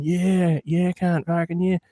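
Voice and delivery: half-voiced drunken yell; imitated drunken yelling. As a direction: play a drunk dude hollering, held back. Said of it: "drunken fucking dudes yelling".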